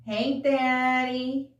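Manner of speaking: slow, playful mock-singer call. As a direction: stretch it out like the song's hook. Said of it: "she goes".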